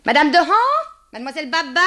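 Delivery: high, loud call for help; crying out. A narrator's reading. Macaré calling her landladies from the door, crying out. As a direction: call their names sharply through the doorway. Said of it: "Elle ouvrit brusquement la porte et cria".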